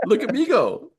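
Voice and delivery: high-pitched, hammed-up elation; mock self-congratulation. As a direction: crow over your own cleverness in a squealing-high voice. Acts out elation at chatbot's praise.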